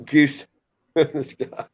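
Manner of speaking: slow, drawn-out catchphrase in a put-on voice; imitating Ralph. Quoting a friend's favourite line, slow put-on voice. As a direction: lightly mimic a friend's old saying. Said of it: "Ralph used to say that".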